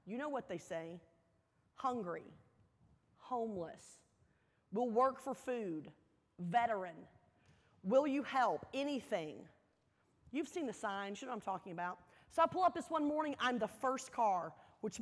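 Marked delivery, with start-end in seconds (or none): none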